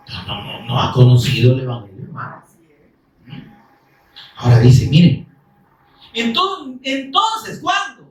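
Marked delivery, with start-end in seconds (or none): none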